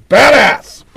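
Badass! shouted high and loud; loud exclaim. Real, not acted. Host alone at the mic, shouts his approval of the drummer, loud. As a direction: belt out Badass! like a cheer.

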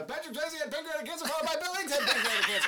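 race caller. Pretend. Mock race call.

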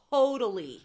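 ordinary delivery

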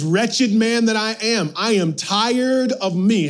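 anguished cry. Retold or imitated. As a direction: cry it out as a weary plea. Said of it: "Paul says".

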